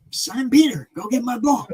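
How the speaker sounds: mock call across the water. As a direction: shout him over to fetch it again. Jesus calls out again.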